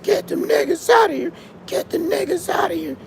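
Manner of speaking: high shrill mock shout; mocking them. Imitating immigrants calling police, high mock yell. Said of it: "y'all be like".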